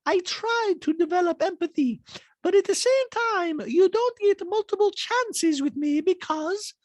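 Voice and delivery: hammed-up Borat-style delivery; Borat-like Bosnian accent. Host imitating Diana's talking head in a Borat-like accent. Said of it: "a Borat element to this".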